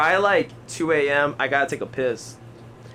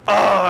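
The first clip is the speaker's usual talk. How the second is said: loud, higher mock moan; imitated bathroom moan; 5 semitones higher, 6 dB louder than usual. Retelling noises from the next stall, imitated moan. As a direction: moan out a raised "oh". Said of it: "I hear".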